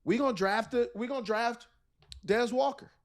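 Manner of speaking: lightly voiced team deciding to draft; team front office. Analyst imitating the team announcing its pick, lightly voiced. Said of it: "they started to go and say".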